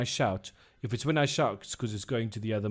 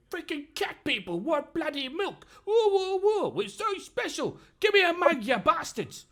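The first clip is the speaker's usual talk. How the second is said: much higher, furious backroom rant; screaming innkeeper. Acted. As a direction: rant at the staff out of earshot. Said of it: "you hear him shouting and screaming at somebody".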